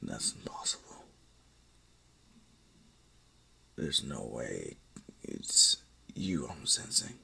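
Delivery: a low, hushed whisper of disbelief; stunned whisper. Acted. Darth Maul to unconscious Izuku, whispering in disbelief. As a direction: breathe it out, unable to believe it.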